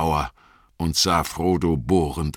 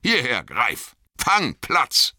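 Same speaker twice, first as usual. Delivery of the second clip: calling the dogs; 8 semitones higher, slower than usual. Acted. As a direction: call the dogs to heel. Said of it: "rief er".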